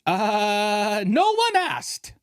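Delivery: high, slow sarcastic drawl; mocking no one asked. Scoffing at a girl who mentions her boyfriend, high sarcastic drawl.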